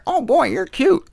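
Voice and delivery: high sing-song coo at a pet bird; cooing up high at a parrot. Retold or imitated. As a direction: coax the bird with a high, sweet line. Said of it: "You can tell a parrot".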